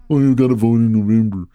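dismissive mock shrug; lightly voiced prim senator; mock Warren. Host parodying a senator's advice, dismissive mock tone. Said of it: "that's what she said on The View".

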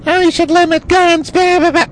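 very high, squealing mock voice; absurdly high parody of naysayers; mocking objectors. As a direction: squeak it out in ridicule. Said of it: "having these people say".

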